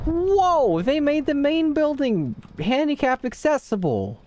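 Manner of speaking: high, loud cry of surprise at the new wall; whoa, then a squeaky high-pitched disbelief; startled high voice; shocked squeal. Real, not acted.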